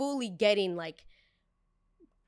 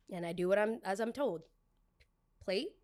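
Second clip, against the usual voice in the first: ordinary talk, nothing marked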